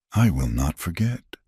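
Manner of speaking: soft whispered voice; softly whispered promise. A narrator's reading. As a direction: whisper it gently. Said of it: "she whispered".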